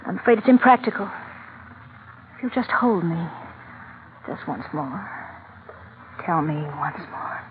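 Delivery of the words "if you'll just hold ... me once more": soft, slow and intimate; a hushed lover's plea, drawn out; tender plea. Acted. Woman begs her departing lover to hold her, softly.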